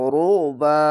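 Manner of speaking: রুবা with a thick, throat-squeezed ain; heavy throat ain. Shown as a demonstration. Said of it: "আইনটিকে মোটা করে গলা চিপে পড়বেন".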